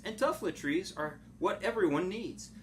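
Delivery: ordinary delivery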